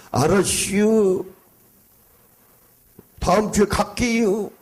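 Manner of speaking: high, slow Chungcheong "알았슈" drawl; mock a Chungcheong local putting you off politely. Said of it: "저도 충청도입니다만". (충청도 사투리로 느릿하게)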